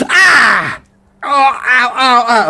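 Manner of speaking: high, loud pained yelps; crying out in pain. Acted. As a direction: yelp as something tears out. NPC hurt as worm comes out.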